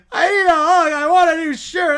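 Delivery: loud, high fake crying; mock sobbing. To the judge, a whiny plaintiff crying for a hug. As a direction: wail like a hurt child.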